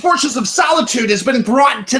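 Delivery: booming announcer shout. Pretend. Movie commentary mock announcement.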